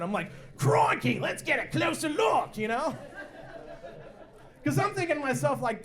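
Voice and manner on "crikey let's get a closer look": put-on Australian Steve Irwin accent, slow and excited; whisper-excited Australian croc hunter. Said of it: "I turn into Steve Irwin".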